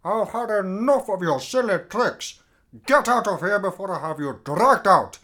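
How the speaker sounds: loud angry dismissal. Acted. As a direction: order him out, furious. Throwing Robert out.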